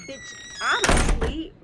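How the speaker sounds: loud, slow, drawn-out exclamation; slow loud outburst. Real, not acted. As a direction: belt it out slowly for drama. Dramatic story punchline.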